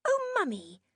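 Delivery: put-on a small girl scolding her mum; child tutting. A narrator's reading. Jenny tuts at her mother, high child's voice. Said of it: "her daughter tutted".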